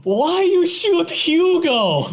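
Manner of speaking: high, slow wail in mock Russian accent; hammed-up Russian-accented howl of complaint; Hugo, Russian accent. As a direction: cry out wounded in broken Russian-accented English. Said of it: "speak of Hugo in a Russian accent".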